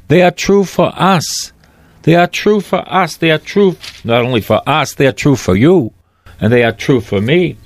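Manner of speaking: insistent pulpit preaching; fervent preaching. Acted. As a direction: preach it with rising conviction. Pastor preaching to congregation.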